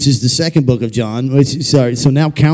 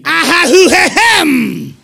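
much higher, slow preacher holler; hammed-up revival preacher warm-up; mock Pentecostal preacher clearing the pipes; 13 semitones higher, much slower than usual. Shown as a demonstration. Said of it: "It's the Pentecostal preacher voice".

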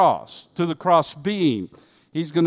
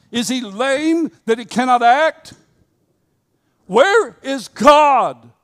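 preacher's cry; 7 semitones higher, 5 dB louder than usual. Retold or imitated. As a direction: thunder it out like an old preacher. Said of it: "what R G Lee used to say".

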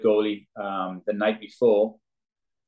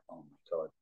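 dismayed murmur; 5 semitones lower, 14 dB quieter than usual. Retold or imitated. Player's dismayed reaction retold.

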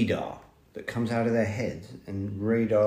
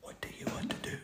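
hushed whisper; 17 dB quieter, faster than usual. Real, not acted. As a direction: whisper the question. Whispering to child.

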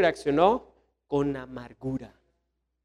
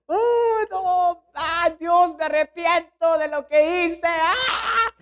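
high, wailing regret, begging God; desperate pleading; 12 semitones higher, much slower than usual. Acted. Esau begging God for forgiveness, high desperate wail. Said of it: "después dijo".

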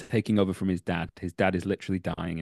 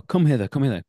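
higher, louder theatrical delivery; mock-stagey, raised and punchy call; stage actor's summons; 6 semitones higher, 5 dB louder, faster than usual. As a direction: project it like a Shakespearean actor. Re-enacts his Shakespeare stage line.

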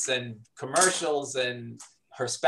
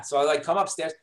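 ordinary talk

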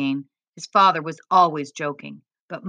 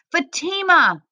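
high-pitched relieved call; cry of relief; 11 semitones higher, slower than usual. A narrator's reading. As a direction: cry out the name on finding a loved one. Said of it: "father cried with relief".

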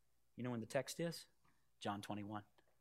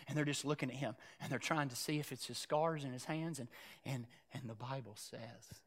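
much softer, lower, hushed narration; hushed storytelling; 9 semitones lower, 15 dB quieter than usual. A narrator's reading. Preacher tells the silent shore scene to the church, hushed. Completely quiet.

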